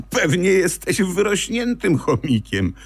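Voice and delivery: high, teasing jab; spitefully. Acted. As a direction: needle him with a mocking grin. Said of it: "powiedziała złośliwie wiewiórka Ewa".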